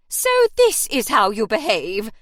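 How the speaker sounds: loud, scandalised matron's accusation; throbbing outrage. Acted. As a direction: boom it out like a shocked landlady. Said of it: "throbbed from the doorway".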